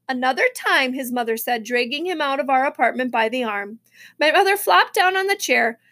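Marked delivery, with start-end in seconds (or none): none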